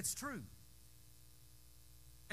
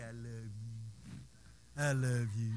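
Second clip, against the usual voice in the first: slow, low crooning I love you; cooing to a pet; 8 semitones lower, slower than usual. Retold or imitated. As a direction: croon it to your old cat.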